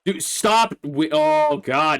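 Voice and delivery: very high, loud, drawn-out exasperation; exasperated yell. Real, not acted. Player yelling as the horse wanders off, exasperated. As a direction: yelp in disbelief at the horse.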